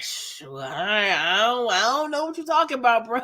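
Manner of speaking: high, nervous playing dumb; imitating a guy dodging the question; mock evasive denial. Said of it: "He was like".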